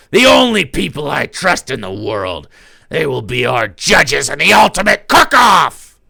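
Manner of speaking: loud grand villain proclamation; villain declaring. Acted. [name] announcing the judges, loud and grand. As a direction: boom it out like a showdown host.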